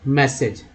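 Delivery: "मैसेज" with e in place of aa, falling; pronunciation demo. Shown as a demonstration. Teacher correcting the vowel for learners, "मैसेज" said with e. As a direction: model "मैसेज" clearly as mes-sej. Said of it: "आ नहीं बल्कि ए पढ़ेंगे".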